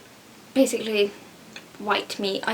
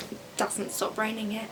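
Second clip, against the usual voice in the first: ordinary delivery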